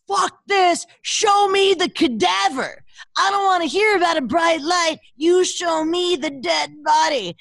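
high, indignant mock shout with drawn-out words; retold screaming. Host reliving her outrage at a UFO episode, shouting. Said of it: "screaming at the top of my lungs".

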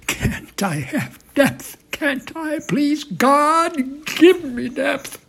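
loud, high, drawn-out begging; desperate plea rising in pitch and volume; pleading, anguished. Acted. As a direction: cry out to God in anguish, slowly. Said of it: "Trump pleadingly anguished".

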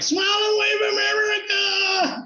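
very high, drawn-out mock crowd shout; imitated group cheer. Strangers at the mall shouting for the video, imitated cheer. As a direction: holler it like a gathered crowd, stretching the last word. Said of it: "And they were like".

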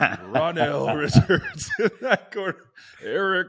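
loud booming fight-night intro; boxing ring announcer. Pretend. Host introduces himself for a mock podcast-voice battle, booming announcer. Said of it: "battle of the podcast voice".

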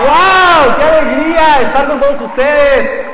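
high, loud stage greeting; calling out to crowd. Real, not acted. Speaker greeting the conference audience, loud and high. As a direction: fire up the whole room.

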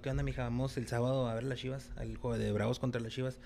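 smooth invite. Retold or imitated. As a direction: sweet-talk her into the game. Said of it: "le digo a la más guapa". (invitación suave y baja)

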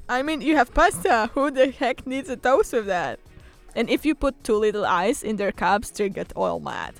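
ordinary delivery

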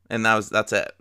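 normal talking voice

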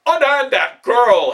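loud, high, slow roar of command; commanding yell. A narrator's reading. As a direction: bellow it to stop the soldier. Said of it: "Hannibal yelled out".

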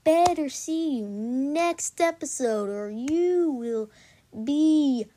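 slow, loud grating drawl; annoying voice. Pretend. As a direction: stretch every word to annoy. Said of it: "I'm gonna stop talking in this annoying voice".

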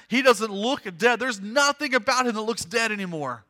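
loud, high, driving sermon climax; preaching. Acted. Preacher retells the raising to the congregation, impassioned and loud. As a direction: preach it at full force, pressing on without letup.

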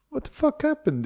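high disbelieving outburst; shocked. Retold or imitated. Retold shock at delays.

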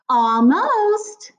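high, drawn-out sing-song cheer; fake cheer. A narrator's reading. Miss Morales answers Finn about breakfast, fake cheer. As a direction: flood the word with phony brightness. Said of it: "her voice flooded with fake cheer".

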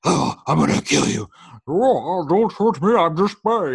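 loud, high, hammed-up growly roar; mock a superhero's fake voice at full strain.

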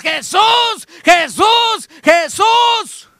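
high, loud cry of a blind man; desperate shouting. Acted. Blind Bartimeo yelling for Jesus, loud desperate cry. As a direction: shout his name over the crowd. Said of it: "pues entonces".

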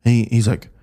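usual speaking voice

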